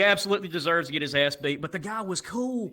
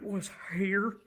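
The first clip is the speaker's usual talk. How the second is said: put-on slow mumbled kid voice; 3 semitones higher, slower than usual. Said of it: "all he has to say".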